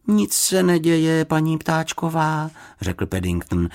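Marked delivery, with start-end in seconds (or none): 0.1-2.5 high, slow bear character voice; slow, reassuring bear; polite little bear. A narrator's reading. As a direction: speak evenly and high, as a courteous small bear.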